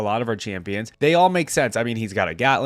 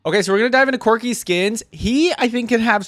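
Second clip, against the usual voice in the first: higher, louder eager kickoff; excited; 10 semitones higher, 5 dB louder than usual. Real, not acted. Telling viewers about the skins, excited.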